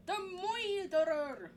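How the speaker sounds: high, slow Scottish "Moiderer". Retold or imitated. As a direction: roll out "The Moiderer" like the Scottish teacher. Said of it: "I heard the word moider instead of murder".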